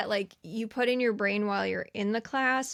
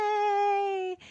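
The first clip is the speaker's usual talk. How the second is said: mock cheer; 12 semitones higher than usual. Class cheering.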